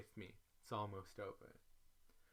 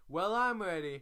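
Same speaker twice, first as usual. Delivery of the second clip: high, loud "I'm ready!"; eager outburst; 9 semitones higher, 12 dB louder than usual. Retold or imitated. Ready to fly home now, high and loud. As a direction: burst out, desperate to leave.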